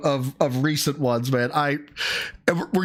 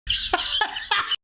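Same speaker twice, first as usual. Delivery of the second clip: high breathless laugh. Real, not acted. Bursts out laughing at clip.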